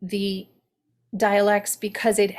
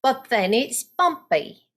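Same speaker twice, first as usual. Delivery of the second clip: Cockney accent; 3 semitones higher than usual. Shown as a demonstration. Coach demos the Cockney flow in a Cockney accent. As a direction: slip into Cockney, up in pitch. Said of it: "So if I'm doing Cockney".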